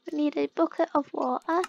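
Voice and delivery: ordinary talk, nothing marked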